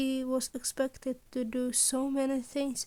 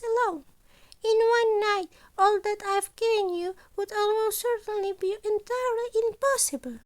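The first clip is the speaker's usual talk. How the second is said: higher, louder voice for the cheerful scheming principal; principal Nezu; 9 semitones higher, 5 dB louder than usual. Acted. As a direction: play Nezu brightly pleased with his impossible demands. Nezu explains the rules.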